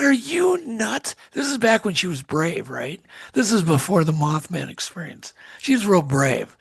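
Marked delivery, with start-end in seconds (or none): 0.0-1.1 higher, drawn-out disbelief; mock incredulous. Retelling his answer to her order to go look, mock incredulous. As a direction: stretch it out in retold incredulity.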